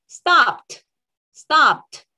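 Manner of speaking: "stopped" ending in a crisp released "t"; final t sound. Shown as a demonstration. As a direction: punch "stopped" as "stopt". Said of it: "el sonido de la E D va a ser".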